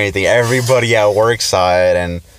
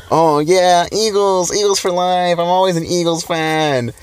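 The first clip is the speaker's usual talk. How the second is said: mocking boast; 10 semitones higher than usual. Friend crowing on a voicemail about the Eagles, mockingly imitated. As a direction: imitate a gushing fan in a high voice.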